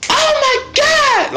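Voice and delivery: gasping, then a very high, slow squeal of "Oh my God"; shocked gasp. Retold or imitated. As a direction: squeal in disbelief at what you see. Shocked reaction to the chapter.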